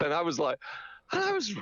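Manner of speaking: ordinary talk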